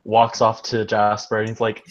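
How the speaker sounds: usual speaking voice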